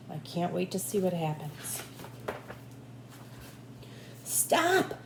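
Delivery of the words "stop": mild mock yell. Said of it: "yelled Big Anthony".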